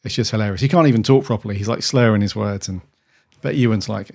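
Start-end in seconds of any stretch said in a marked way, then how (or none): none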